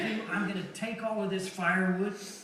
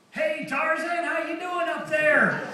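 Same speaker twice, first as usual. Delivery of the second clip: higher, jeering call; mocking heckler; 7 semitones higher, 4 dB louder than usual. Neighbors jeering at Noah, higher mocking yell. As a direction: taunt him like a picket-line heckler.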